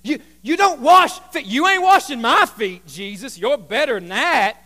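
loud, emphatic objection; Peter protesting. Acted. Peter refusing to let Jesus wash him, loud protest. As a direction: push back hard with punched words. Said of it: "I love what Peter says here".

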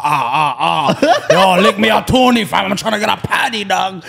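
loud road man singsong. Retold or imitated. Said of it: "it's just a road man going".

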